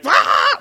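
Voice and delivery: high mock newborn wail; imitated baby scream. Father imitating his newborn daughter, high mock wail. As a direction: yelp like a crying newborn. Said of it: "was screaming like".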